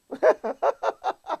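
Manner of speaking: high laugh in a quick burst; scoffing laugh. Real, not acted. Preacher after his million-dollars analogy, laughing.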